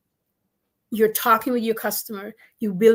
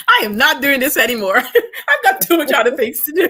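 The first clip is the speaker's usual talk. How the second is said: louder, laughing through the words; laughing while talking; 4 semitones higher, 8 dB louder than usual. Real, not acted. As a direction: joke it out, still laughing.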